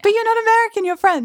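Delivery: high-pitched, incredulous objection; astonished. Real, not acted. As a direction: blurt it in disbelief, pitched well up. Astonished at learning guest's nationality.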